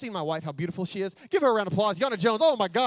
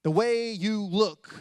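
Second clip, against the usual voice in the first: normal talking voice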